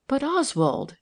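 wifely protest, lightly acted. A narrator's reading. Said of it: "she protested".

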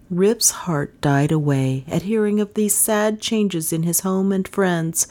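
ordinary delivery

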